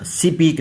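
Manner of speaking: स्पीक said "si-peek", short "i" after s; wrong added vowel. Shown as a demonstration. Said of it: "नहीं बोलना".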